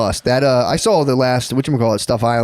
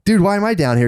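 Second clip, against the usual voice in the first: higher, bewildered complaint; confused plea; 9 semitones higher than usual. Retold or imitated. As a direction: sound baffled and a bit whiny. Dream self confused in basement.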